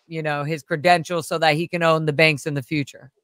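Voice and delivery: ordinary delivery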